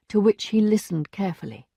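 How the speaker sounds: usual speaking voice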